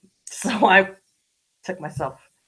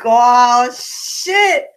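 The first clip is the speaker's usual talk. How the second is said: high alarmed "oh, shit"; pitched-up panicked exclamation; alarmed surprise; 8 semitones higher, 6 dB louder than usual. Retold or imitated. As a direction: squeak out the dread. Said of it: "I'm like".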